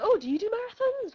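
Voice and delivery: high, loud, keen question; chatty stranger. Retold or imitated. Runner quizzing his struggling companion, high and mock-keen. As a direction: imitate an over-eager running enthusiast.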